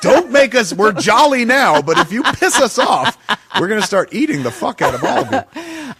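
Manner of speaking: loud, laughing fake warning; laughing mock threat. Warning thin people in jest, loud and laughing. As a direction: threaten them while cracking up.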